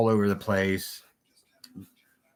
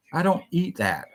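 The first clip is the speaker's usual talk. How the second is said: higher, indignant refusal; 8 semitones higher than usual. Retold or imitated. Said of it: "I was like".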